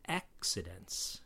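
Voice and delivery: "accidents" slowly as AK-sidents; K S sound. Shown as a demonstration. Examples of double C words, slow K S sound. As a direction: draw out "accidents" to show k then s. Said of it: "are pronounced with a K S".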